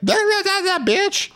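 high falsetto taunt ending in bitch; hammed-up high taunt; mock taunting boy. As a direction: jeer at her in a squeaky voice. Boy voiced in retold fight.

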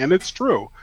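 usual speaking voice

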